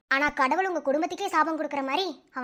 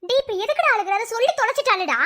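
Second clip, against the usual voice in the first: high, loud, panicked outburst; alarmed shout; 9 semitones higher, 8 dB louder than usual. Acted. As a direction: yell at him in alarm. Alarmed friend shouting at crier. (பதற்றமான கூச்சல்)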